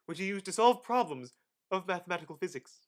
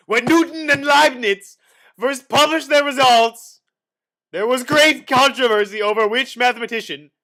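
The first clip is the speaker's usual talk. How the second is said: dramatic shout; 7 semitones higher, 18 dB louder than usual. Acted. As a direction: boom it out like a town crier. Dramatic shout about the controversy.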